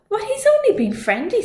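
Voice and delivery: high innocent reassurance; with a smile. Acted. Una smiles innocently at the giant. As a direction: smile through it. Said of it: "said Una with a smile".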